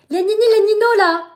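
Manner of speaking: high, loud greeting to the fans; loud, high excited shout-out; excited call-out. Real, not acted.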